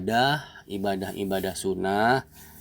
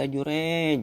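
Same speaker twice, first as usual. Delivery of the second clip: higher, sing-out call on the name; mother calling out; 6 semitones higher than usual. Acted. Mother visiting her praying son, calling out to him. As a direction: call the greeting out, lifting the name high.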